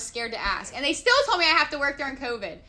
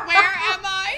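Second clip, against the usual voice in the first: higher, louder, disoriented; mock bewildered; 6 semitones higher, 5 dB louder than usual. Recalling the wrong bathroom to Brittany, mock bewildered. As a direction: play the lost girl who wandered in. Retold confusion in frat bathroom.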